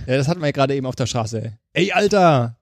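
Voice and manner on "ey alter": playful Berlin bro intro voice; Berlin street slang. Pretend. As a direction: hype it like a Kreuzberg rapper. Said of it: "Ist halt Berlin".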